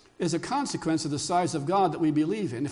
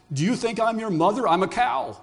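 rude cow, higher and louder than the narration; 3 semitones higher, 4 dB louder than usual. Acted. Cow brushing off a little lost bird, very rudely. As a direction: snap back with no respect at all. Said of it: "You know, very rudely".

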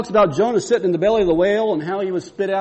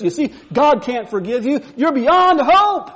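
a sneering devil taunting, higher and louder, slowing at the end; mocking devil; 7 semitones higher, 6 dB louder than usual. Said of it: "he mocks me and says".

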